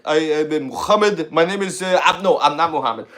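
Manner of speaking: put-on Middle Eastern accent. Comic replaying his stand-up bit for the host, Middle Eastern accent. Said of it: "I started out with that accent".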